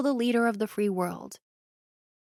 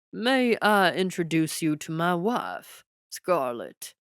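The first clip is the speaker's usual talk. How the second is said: slow, proud Texas drawl; lightly voiced Texas president showing off his wife; Texas twang; 2 semitones lower, slower than usual. A narrator's reading. The Texas president presents his wife, slow twang.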